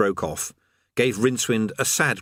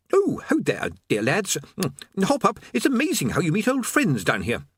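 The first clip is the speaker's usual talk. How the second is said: higher, jolly greeting; cheery old acquaintance; 10 semitones higher than usual. Acted. Old friend to Rincewind and Eric, a higher jolly hello. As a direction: hail old friends merrily.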